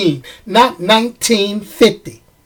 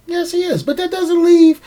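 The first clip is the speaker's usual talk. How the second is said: high-pitched concession; 9 semitones higher than usual. Real, not acted. Conceding the fans' point in high voice.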